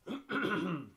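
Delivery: sharp throat clear after choking. Real, not acted. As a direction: clear the throat hard, still irritated. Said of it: "I almost choked on drinking tea".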